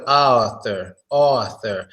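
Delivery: author with an open aw; correct author. Shown as a demonstration. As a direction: say author opening the jaw wide, aw. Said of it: "it's like".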